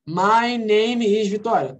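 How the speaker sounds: drawn-out, word-by-word "My name is Vitória"; stilted textbook answer; unnaturally slow. Shown as a demonstration. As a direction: overarticulate "My name is Vitória" slowly. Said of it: "você acha que nós estamos assim?".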